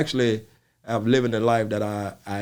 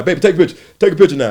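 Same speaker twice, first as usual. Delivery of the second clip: louder, higher eager fan call; eager demand; 8 semitones higher, 11 dB louder, much faster than usual. Retold or imitated. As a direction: insist on a picture now.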